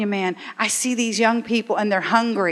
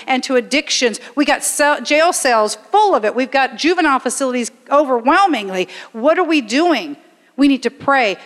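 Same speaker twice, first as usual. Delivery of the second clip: raised, driving preacher voice; preaching; 3 semitones higher, 4 dB louder than usual. Acted. Preacher rallying the congregation to pray, at full force. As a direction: preach it hard to the church.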